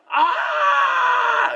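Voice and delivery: high, sustained mock scream. Moviegoer at all the Transformers, high mock scream. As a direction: scream as if the noise is too much. Mimics reaction to deafening film.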